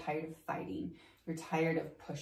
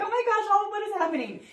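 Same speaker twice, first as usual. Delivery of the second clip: much higher, louder, fast panicked squeal; mock panic; 9 dB louder, faster than usual. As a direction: blurt it out in hammed-up alarm. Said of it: "You're like".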